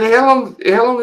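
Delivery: high, disbelieving repeat of the question; incredulous retort. Retold or imitated. Retelling a census phone call.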